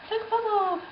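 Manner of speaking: squeaky imitation of a French tourist with a French accent. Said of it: "that was a French accent".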